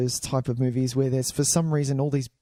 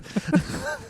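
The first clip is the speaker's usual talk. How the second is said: sudden high-pitched laugh. Real, not acted. Iceland joke.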